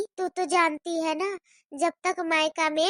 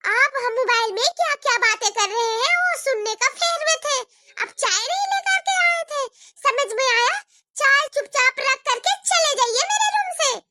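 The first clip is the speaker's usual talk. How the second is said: angry sister-in-law; 6 semitones higher than usual. Acted. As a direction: scold the intruding sister-in-law sharply. Bhabhi orders bua out angrily. (ऊँची आवाज़ में गुस्से से डाँटती भाभी)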